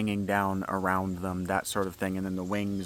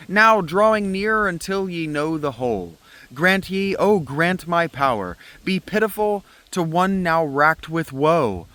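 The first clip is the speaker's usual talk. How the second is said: loud, high anguished declamation; tragic hero declaiming; 8 semitones higher, 6 dB louder than usual. Acted. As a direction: cry out his suffering to the chorus. Reads Prometheus dramatically.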